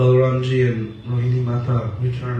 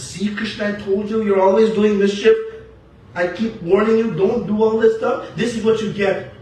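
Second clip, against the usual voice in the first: put-on higher, chiding older brother; Balaramji scolding; 6 semitones higher, 3 dB louder than usual. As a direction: lecture a naughty little brother. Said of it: "And Balaramji says".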